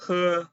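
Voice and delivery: "h" as a breathy "huh"; model syllable. Shown as a demonstration. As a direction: pronounce "h" like "huh" for students. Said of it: "H pronounced as h".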